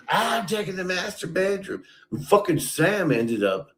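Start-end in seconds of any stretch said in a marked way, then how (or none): 0.1-1.8 high, smug put-on voice of an entitled housemate; mocking Ray. Retelling Ray taking the room, high smug mock voice. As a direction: toss it off like it's already yours. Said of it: "And you go".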